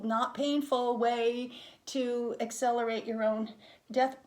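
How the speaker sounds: ordinary delivery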